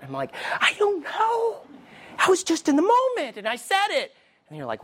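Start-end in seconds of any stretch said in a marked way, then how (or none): 0.6-4.1 defensive self-reply. Acted. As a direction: plead an excuse, pitched way up. Acted defensive reply to himself.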